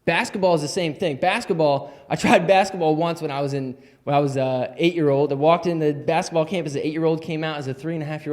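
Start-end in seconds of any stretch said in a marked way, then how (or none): none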